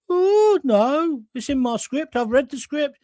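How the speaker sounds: indignant high Brummie protest; Brummie cleaner. Retold or imitated. Cleaner defending herself to floor manager, high Brummie voice. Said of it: "Amy Turtle was the Brummie cleaner".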